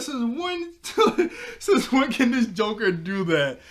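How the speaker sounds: very high, loud squeal breaking into laughter; laughing disbelief. Retold or imitated. Amazed by the cartoon sword, squealing with laughter. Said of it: "I was like".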